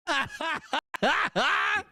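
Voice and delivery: high-pitched cackle; laughing. Real, not acted. Heckler laughing at own money joke, high cackle. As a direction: laugh in high, separate bursts.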